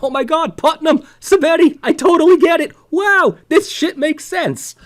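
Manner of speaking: high, loud, hammed-up amazement; squealing high mock delight, slowing at the end; mock excited realization. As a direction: gush like a sudden revelation. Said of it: "You're like".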